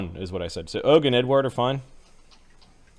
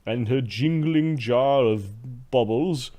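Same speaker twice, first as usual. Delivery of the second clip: haughty slave master; 3 semitones higher, slower than usual. Acted.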